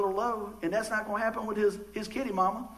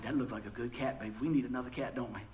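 low, hushed husband pleading his case; imitated under-the-breath persuasion; soft coaxing; 9 semitones lower, 9 dB quieter than usual. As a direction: sweet-talk your wife quietly. Acts out coaxing his wife.

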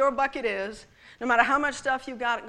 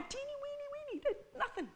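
high, soft little voice with a laugh; tiny voice; 12 semitones higher, 17 dB quieter than usual. Acted. Preacher shrinking his voice over the mustard seeds, high and soft. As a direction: make the seeds sound tiny.

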